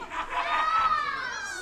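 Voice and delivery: loud high evil cackle; villain cackle. Acted. Villain laughs.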